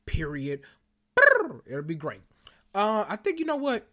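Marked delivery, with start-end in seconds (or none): 1.1-1.6 high, loud rolled "brrr" of delight; excited trill. Real, not acted. Cheering the new Wanda comics: loud, high excited trill. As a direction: trill with glee.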